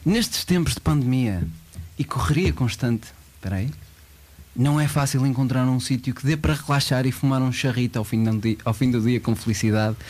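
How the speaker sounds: usual speaking voice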